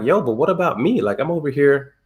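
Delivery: imitating his own hurt whine; hurt complaint. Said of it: "my feelings were hurt 'cause I'm like".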